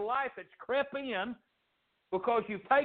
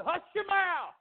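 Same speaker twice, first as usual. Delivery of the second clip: high, harsh hushing; acted crowd telling someone off; ugly scolding; 9 semitones higher than usual. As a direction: scold the beggar in a high raised voice. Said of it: "They were ugly".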